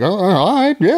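high, amused all right; amused acceptance. Retold or imitated. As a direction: play along, amused. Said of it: "I was like".

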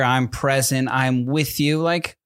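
ordinary delivery

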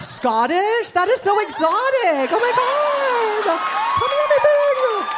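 mock gushing. Mocking a nosy asker with high gushing delight. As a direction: squeal over it like it's exotic.

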